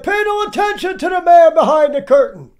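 high, loud shout; loud, high-pitched quoted command; mock booming proclamation. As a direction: call it out loudly like the man behind the curtain. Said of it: "He said".

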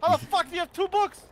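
high, loud incredulous yell; outraged at two books; shocked disbelief. Real, not acted. Disbelief, rising and louder.

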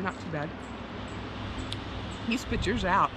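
normal talking voice

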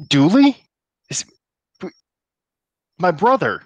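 high, loud gasping disbelief; shocked cry. Acted. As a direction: call out stunned, voice jumping up. NPC shocked to see brother.

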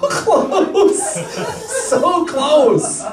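high, drawn-out groan of a near miss; mock agony. Host teasing contestant over a wild guess, mock agony. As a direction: stretch "close" out, laughing along.

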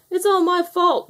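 high-pitched storybook wail. A narrator's reading. Rag owning up to Mother Hen, wailing. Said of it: "wailed Rag".

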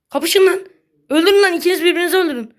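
high, loud gleeful yelling at the players; loud fast cheering for the players to kill each other; egging them on. Real, not acted. Cheering enemies to fight each other.

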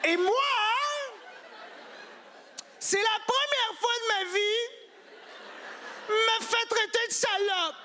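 very high slow outraged squeal; shocked falsetto. Acted.

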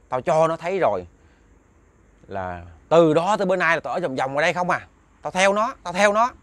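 high, assertive ghost voice, retold; possessing spirit. Spirit in Đức answering the father's interrogation, defiant possessed voice. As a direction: act out the spirit boasting, pitch pushed up.

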